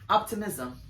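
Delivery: ordinary talk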